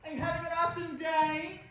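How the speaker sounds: high, loud, chirpy service greeting; perky counter girl. Acted. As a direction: put on an over-eager takeout girl voice. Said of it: "the girl behind the counter said".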